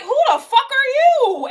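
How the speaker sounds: high, sneering challenge; mock confrontation. Mocking people confronting the clients, high and sneering. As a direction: throw the question at them.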